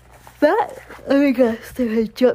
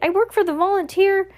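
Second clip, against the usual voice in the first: high-pitched hurried-to-explain plea; frantic cry; 10 semitones higher than usual. A narrator's reading. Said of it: "Jacques cried frantically".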